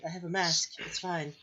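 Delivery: ordinary delivery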